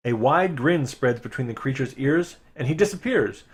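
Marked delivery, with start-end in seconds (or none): none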